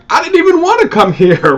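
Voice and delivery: high, loud comic whine; whiny youngest brother. Retold or imitated. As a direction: complain like a wronged kid brother.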